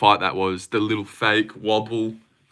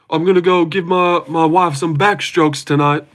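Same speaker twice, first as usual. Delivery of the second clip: high-pitched comic sidekick impression; imitated comic-relief bragging, pitched up; Derek Lewis; 7 semitones higher than usual. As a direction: put on an American fighter's voice for a crude boast.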